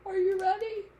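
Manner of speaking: high, teasing build-up; building suspense. A narrator's reading. Teacher primes kids for the sneeze, high teasing. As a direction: ask it high like a sneeze about to come.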